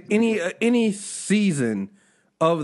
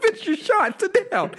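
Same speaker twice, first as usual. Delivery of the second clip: exasperated mock scolding; 12 semitones higher, slower than usual. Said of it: "I'm like".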